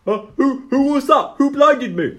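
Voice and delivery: high, loud angry troll; a blinded troll demanding answers. Acted. Troll asks who blinded it.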